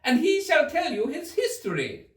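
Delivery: very high, loud royal demand; loud Queen. A narrator's reading. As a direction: bark the question at Alice. The Queen orders.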